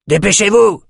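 high, loud call to hurry. A narrator's reading. Raider calling his lingering companions to hurry back. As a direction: shout across to the stragglers. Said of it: "lança-t-il à ses compagnons".

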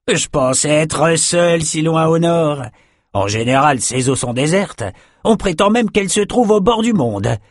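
high-pitched fisherman character; jovial fisherman, just laughed. Acted. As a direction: play a hearty fisherman greeting friends.

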